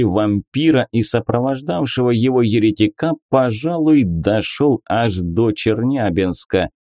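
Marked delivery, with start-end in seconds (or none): none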